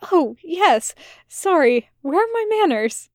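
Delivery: rising, flustered apology; mild fluster, pitch climbing; a little flustered. Acted. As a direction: caught off guard, hurry to introduce. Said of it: "a little flustered".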